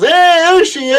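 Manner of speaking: high, bright welcome back; excited greeting. Real, not acted. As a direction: greet her happily. Greets cohost returning.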